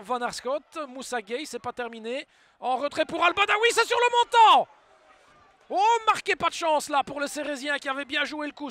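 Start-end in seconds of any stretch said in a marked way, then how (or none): 2.6-8.7 excited sports commentary. Real, not acted. Shot hits the post.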